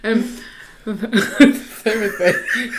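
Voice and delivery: lisping and laughing, slowly. Real, not acted. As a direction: lisp she can't shake off. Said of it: "now I can't stop it".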